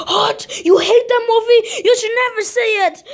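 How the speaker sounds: high, indignant cry; can't believe he said that; outraged disbelief. Acted. Kid outraged his friend hates the movie, high cry.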